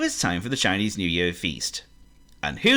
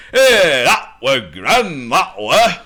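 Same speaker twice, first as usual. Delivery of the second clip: northern pig voice; 7 semitones higher, 8 dB louder, much slower than usual. Acted.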